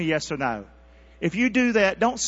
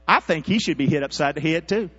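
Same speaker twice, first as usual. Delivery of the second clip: ordinary talk, nothing marked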